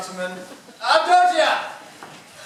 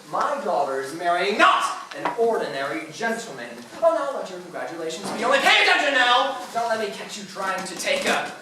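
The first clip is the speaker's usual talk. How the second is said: normal talking voice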